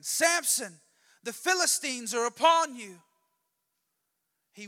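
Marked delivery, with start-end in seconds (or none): 0.0-3.0 loud, high-pitched alarm shout; Delilah's yell. Acted. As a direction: call out the warning at full force. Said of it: "Then she yells again".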